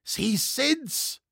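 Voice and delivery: high, drawn-out alarm cry; panicked cry. A narrator's reading. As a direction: cry out in alarm as a frightened crowd. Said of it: "schrie alles und rannte in wilder Hast". (angstvoller Alarmschrei)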